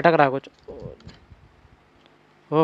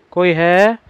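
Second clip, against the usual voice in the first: raised call with rising end; 3 semitones higher, 4 dB louder, much slower than usual. Real, not acted. Player calling into the shut dark room, raised questioning call. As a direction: call to see if anyone is there.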